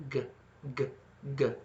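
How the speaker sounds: g said with a little voiced tone; voiced g repeated alone; bare g sounds with some tone; G sound demo. Shown as a demonstration. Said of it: "what about the G".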